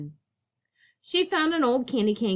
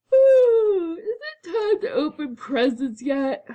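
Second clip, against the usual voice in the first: drawn-out sleepy yawn, higher and louder; 9 semitones higher, 6 dB louder, slower than usual. A narrator's reading. Cousin just woken for presents, yawning slowly. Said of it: "yawned Cousin Elwood".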